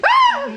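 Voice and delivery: high piercing scream of fright; a sharp startled shriek. Acted. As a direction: shriek in sudden panic. Pumuckl screams at cat.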